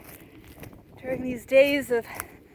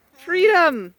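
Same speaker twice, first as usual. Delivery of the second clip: shout; 3 semitones higher, 7 dB louder than usual. Acted. Announcing the alpacas' release.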